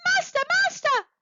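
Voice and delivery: very high, loud cry; a tiny magic harp shrieking for help. A narrator's reading. Magic harp calls its master, very high and loud. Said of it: "the harp cried".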